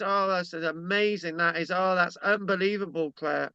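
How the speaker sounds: man hiding his disappointment from Claire, mock praise; fake politeness. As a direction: pretend to be impressed, deflated. Mock enthusiasm at axe gift.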